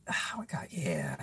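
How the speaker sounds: sighed, low half-whisper; hushed dismay. Retold or imitated. Streamer reacting to crazy movies, hushed and dismayed. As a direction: sigh it out under your breath.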